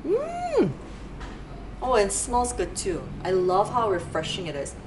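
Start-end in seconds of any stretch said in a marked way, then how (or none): 0.1-0.7 appreciative hum. Real, not acted. Smelling body scrub.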